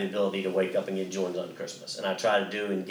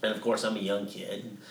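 ordinary talk, nothing marked

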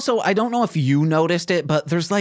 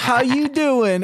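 higher, bright Southern greeting; Southern accent; 8 semitones higher than usual. Retold or imitated. Co-host mocks comic rappers greeting, higher Southern voice. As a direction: lift it up with a light Southern lilt. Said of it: "I know I sounded a little Southern there".

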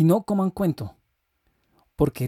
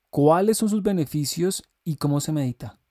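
normal talking voice

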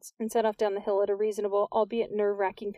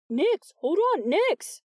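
loud, higher call after her; 10 semitones higher, 5 dB louder, much slower than usual. A narrator's reading. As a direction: call her back urgently. Calling after Nix.

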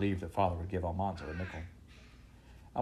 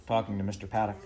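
ordinary delivery, nothing marked

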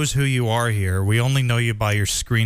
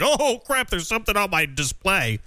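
startled; 7 semitones higher than usual. Retold or imitated. Imagined reaction to a display popping up.

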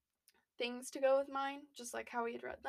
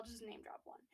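whispering; 12 dB quieter than usual. Real, not acted. Whispered name drop aside.

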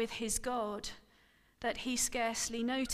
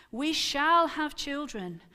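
firm and louder, certain; 4 semitones higher, 7 dB louder than usual. Acted. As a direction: state it as settled fact. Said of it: "Abram said firmly".

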